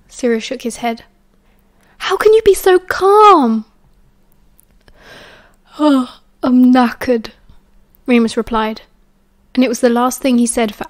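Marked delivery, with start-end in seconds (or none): 2.0-3.6 high, loud, drawn-out disbelief; exasperated. A narrator's reading. Sirius cannot believe Remus is calm, exasperated. 5.8-7.3 slow, worn-out sigh of words; exhausted. A narrator's reading. Remus answers Sirius before sleeping, exhausted.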